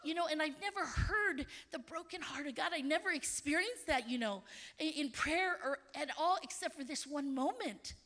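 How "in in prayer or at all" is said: usual speaking voice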